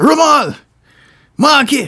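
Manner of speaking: loud, high shouted names; calling out. Retold or imitated. Imitating a father calling his sons one by one, shouted call. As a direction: holler each name as an acted summons.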